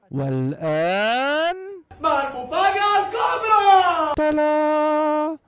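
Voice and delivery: mock announcer reveal. Unveiling the food to his friends, hammed-up game-show announcer. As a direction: stretch it out like a game-show host unveiling a prize.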